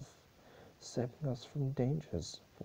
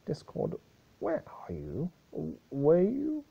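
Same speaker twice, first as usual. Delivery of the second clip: startled; 7 semitones higher, 5 dB louder than usual. Acted. Celestia startled by Discord.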